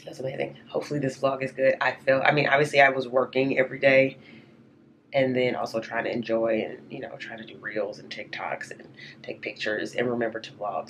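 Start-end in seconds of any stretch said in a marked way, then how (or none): 3.8-10.9 raspy whisper. Real, not acted. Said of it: "I have no voice".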